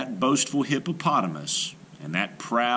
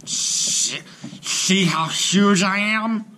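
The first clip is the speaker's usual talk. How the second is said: slow booming boast, pitched high; boastful hippo cry; 12 semitones higher, 6 dB louder, much slower than usual. A narrator's reading. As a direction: crow like a huge proud hippo. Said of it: "cried Hippopotamus".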